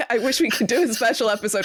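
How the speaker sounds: fast and laughing; a wistful laughing wish. Real, not acted. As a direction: rush it out through a laugh. Guest laughing at the idea.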